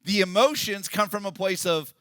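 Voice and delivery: high, loud, driving sermon voice; fiery pulpit delivery; full-force preaching. Acted. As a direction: preach it out to the whole room.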